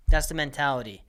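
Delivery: ordinary talk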